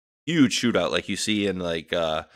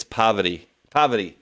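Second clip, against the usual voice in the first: "poverty" with the R dropped, New England style; New England accent; 4 dB louder than usual. Shown as a demonstration. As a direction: say "poverty" like a New Englander, PAH-vuh-tee. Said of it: "If I say it with my New England".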